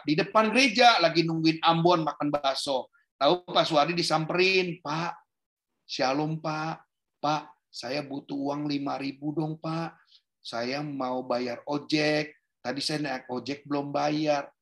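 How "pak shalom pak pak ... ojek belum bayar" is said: soft, meek request for a little money; polite soft pleading. Acted. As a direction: act a gentle-spoken stranger asking a favour, hushed. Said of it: "tapi kalau ngomong baik-baik".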